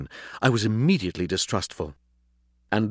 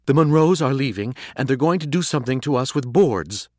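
higher, louder urgent announcement; urgent news; 6 semitones higher, 4 dB louder than usual. A narrator's reading. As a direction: blurt out the alarming news. Dog urgently breaking bad news.

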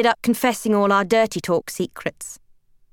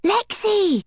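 high-pitched call across the street; excited high yell; 12 semitones higher than usual. A narrator's reading. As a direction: holler the name high, like a friend calling out. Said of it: "Debs yells".

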